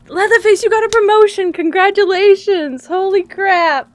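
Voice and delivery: loud, high-pitched cheering; excited congratulations. Real, not acted. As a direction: shout your congratulations to a friend. Congratulating a viewer on promotion.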